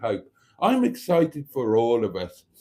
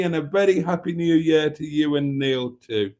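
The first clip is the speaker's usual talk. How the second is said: usual speaking voice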